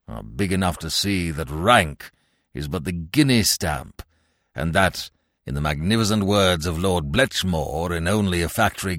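put-on low, sententious old man's voice; pompous old gentleman. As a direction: declaim it grandly, deep and deliberate. Plays pompous old Little.

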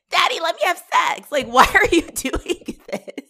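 high, loud whining plea, laughing; mocking whiny daughter. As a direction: whine at daddy like a spoiled kid. Mocking Emma pleading with dad.

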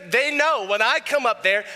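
ordinary talk, nothing marked

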